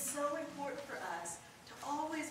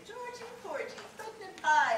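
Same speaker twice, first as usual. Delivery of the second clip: put-on child reciting nursery rhyme; 11 semitones higher, 7 dB louder than usual. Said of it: "and I'd say".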